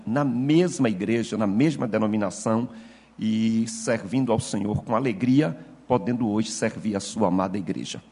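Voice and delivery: ordinary talk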